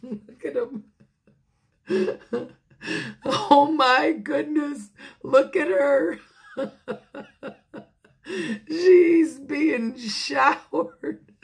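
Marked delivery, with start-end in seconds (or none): 3.2-11.3 laughing, high and loud; tickled, breaking into laughs; amused. Real, not acted. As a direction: exclaim through real laughter. Reader laughing at the picture.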